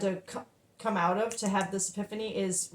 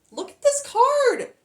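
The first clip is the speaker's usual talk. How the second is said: much higher and louder, slow; excited; 7 dB louder, slower than usual. Real, not acted. Reader urges viewers to look at the card, thrilled. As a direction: thrust the card at the camera. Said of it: "I'm so hyped and happy".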